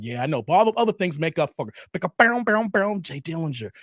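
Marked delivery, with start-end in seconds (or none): 1.9-3.0 rhythmic plucked-string mouth noises; mouth-played riff; imitated string riff. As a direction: mimic a little bass lick vocally. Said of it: "Only a four string".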